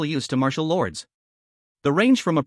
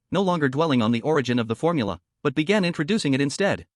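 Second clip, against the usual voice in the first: plain reading voice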